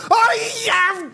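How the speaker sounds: high, joyful yell of oh yeah; a burst of real delight; excited cheer. Real, not acted. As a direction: cheer it out as the chance lands. Celebrating a goal chance.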